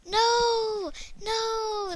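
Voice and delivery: high, loud wail of no; real cry of dismay. Real, not acted. Player losing the Sky High, loud high wail.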